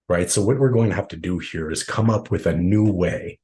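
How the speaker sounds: usual speaking voice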